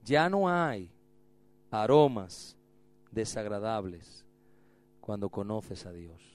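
low, fading call to repeat; slow recital. Shown as a demonstration. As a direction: recite slowly for them to repeat. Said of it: "Diga conmigo".

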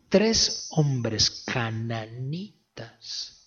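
ordinary delivery

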